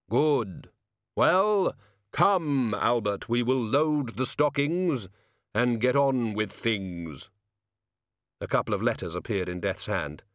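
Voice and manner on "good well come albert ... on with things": slow, drawn-out voice of Death; solemn Death. Acted. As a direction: intone each word slowly, like Death.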